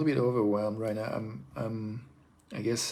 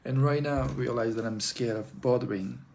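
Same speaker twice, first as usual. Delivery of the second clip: normal talking voice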